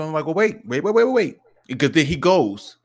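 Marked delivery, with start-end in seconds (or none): 0.3-1.4 rapid rising "wait" repeats; hurried interruption. Retold or imitated. Dad stopping his flustered son, urgent and rapid. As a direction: cut in urgently, pitch climbing.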